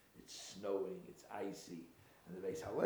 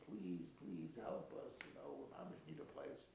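desperate plea; 4 semitones higher, 7 dB quieter than usual. Acted. Freezing traveler begs the innkeeper for a room, softly desperate. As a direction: plead gently, as a stranded traveler would. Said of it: "he's desperate".

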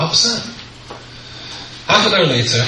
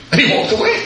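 high, slow, disbelieving shout, laughing; laughing outrage that he walked off; incredulous shout; slower than usual. Real, not acted. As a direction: cry out in comic disbelief, far higher. Incredulous outburst at the man.

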